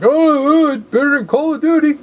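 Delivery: high, loud whiny gamer voice; mock an overexcited fanboy. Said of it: "and everyone's like".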